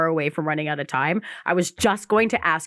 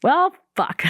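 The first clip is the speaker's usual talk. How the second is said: high, loud, stunned 'well, fuck'; stunned curse; 12 semitones higher, 5 dB louder than usual. Retold or imitated. Retelling her shock to the cohost, stunned high curse. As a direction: blurt it out in mock disbelief.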